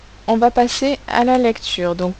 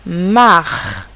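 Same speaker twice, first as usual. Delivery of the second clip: ordinary delivery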